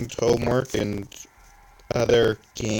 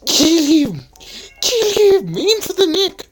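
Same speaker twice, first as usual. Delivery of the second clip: much higher, louder cartoon shout; high shrill battle cry; 5 dB louder than usual. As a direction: yell orders in a squeaky voice. Voicing game characters shouting.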